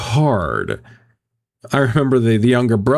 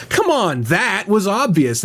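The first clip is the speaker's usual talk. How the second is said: mock condescending; 7 semitones higher than usual. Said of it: "the fourth one would be like".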